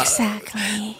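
soft whispered exactly. Acted. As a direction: breathe it out. Whispered agreement.

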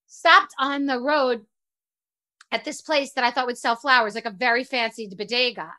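ordinary delivery

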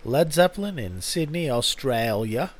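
a loud, badly faked Australian opener; mock Australian accent, half-hearted; terrible Australian accent. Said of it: "That was a terrible accent".

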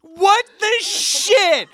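high, drawn-out mock wail; crying. Son retelling his reaction to finding dad downstairs, crying wail. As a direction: imitate a tearful, outraged cry, very slowly. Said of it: "I start crying".

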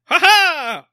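a sudden, loud, high "ha ha"; loud, high laugh that breaks off the topic; loud burst of laughter. Real, not acted. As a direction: bark out a big laugh. Laughing at the absurd scene.

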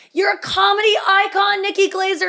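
high, loud crowd yell of "comedy icon"; fan shouting. Retold or imitated. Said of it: "like, shouted".

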